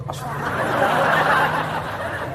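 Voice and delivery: slow unvoiced whisper with a laugh; mock pious whisper while staring; whispered istighfar. Shown as a demonstration. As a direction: breathe it out while peeking. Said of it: "Jangan kau tutup istighfar baru kau melotot".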